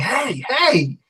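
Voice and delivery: half-yelled indignant challenge; yelling. Acted. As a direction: call out in objection, not at full volume. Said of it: "I start yelling".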